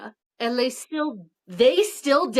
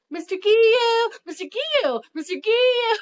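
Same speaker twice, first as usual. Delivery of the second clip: high, loud, drawn-out calling; eager kid calling out; 11 semitones higher, 5 dB louder than usual. Retold or imitated. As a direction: call out like an excited kid. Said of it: "you can just see".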